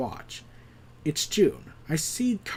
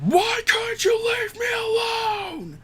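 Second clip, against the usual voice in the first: high, slow, anguished yell; raging cry, pitched way up and drawn out; enraged crying shout; slower than usual. Retold or imitated. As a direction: scream it out through tears of rage. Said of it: "in his rage".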